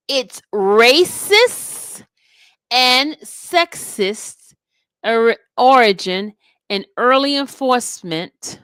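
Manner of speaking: loud, deliberate declamation with weighty pauses; emphatic court argument read-aloud. Retold or imitated. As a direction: punch the key words as if addressing the court. Reads a lawyer's closing argument aloud.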